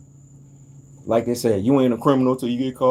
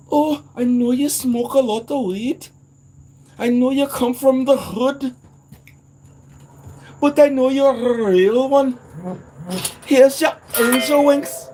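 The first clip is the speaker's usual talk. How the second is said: high, louder put-on voice of the welcoming angel; angel at the gates; 13 semitones higher, 4 dB louder than usual. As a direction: greet him like a gentle angel.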